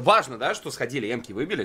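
ordinary talk

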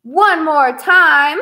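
loud slow call. Shown as a demonstration. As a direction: stretch it out for the echo. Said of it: "a repeat after me song".

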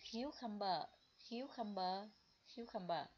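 "cucumber" said three times, clear and even; model pronunciation. Shown as a demonstration. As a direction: model "cucumber" for the class to repeat. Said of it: "em sẽ đọc là". (đọc mẫu "cucumber" ba lần)

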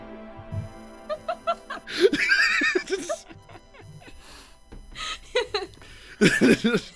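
helpless high laughter; real giggling fit; long laughing fit. Real, not acted. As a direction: crack up and wheeze on. Table cracks up at flashback.

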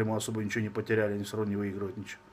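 ordinary talk, nothing marked

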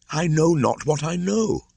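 high, lost and pleading; a troubled boy who cannot explain himself; bewildered boy. Acted. Mowgli, bewildered.

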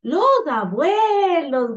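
high, loud, drawn-out call; excited shout. Acted. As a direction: cry it out slowly to the grandparents. (grito alegre y alargado)

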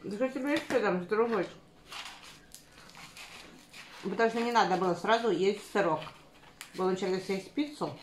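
chewing between words; muffled by food; mouth full of pizza. Real, not acted. Seen 0: Woman bites pizza just before; cheeks and lips show chewing until ~8s.

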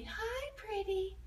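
drawn-out, high "Hi, pretty"; slow, high coo to a cat; very slowly. Shown as a demonstration. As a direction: stretch every word out slowly. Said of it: "They said very slowly".

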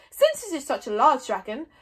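loud, authoritative chief. A narrator's reading. Stoic laying out his plan, chief-like. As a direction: address the tribe with command. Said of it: "in his most chief-like manner".

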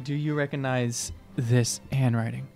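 ordinary talk, nothing marked

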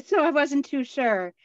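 ordinary talk

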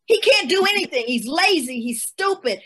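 mock-protest, pleading to save him; frightened mother hamming up the insults; mother denigrating son. Mother runs down her son.

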